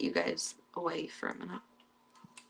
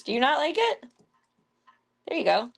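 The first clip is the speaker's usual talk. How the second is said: pet talk; 7 semitones higher, 4 dB louder than usual. Real, not acted. Asking the cat about the shrimp in a high coo.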